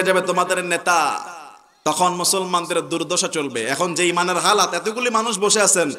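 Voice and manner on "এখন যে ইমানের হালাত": usual speaking voice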